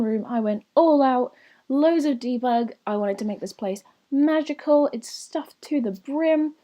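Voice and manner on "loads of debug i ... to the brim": normal talking voice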